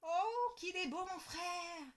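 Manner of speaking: put-on high, excited child; girl marvelling at her brother; delighted exclaim. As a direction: gush over the baby. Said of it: "s'écrie Lola".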